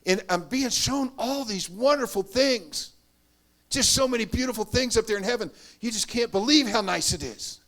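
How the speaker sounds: ordinary talk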